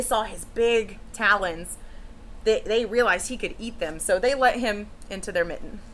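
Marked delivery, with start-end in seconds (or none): none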